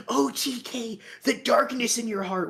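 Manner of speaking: high, preachy mock Cody voice. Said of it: "The best thing Cody did would just be like".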